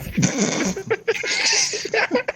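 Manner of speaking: squeaky high laugh bursting out; giggling fit; high giggle. Real, not acted. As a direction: laugh up high at your own joke. Laughs after own joke.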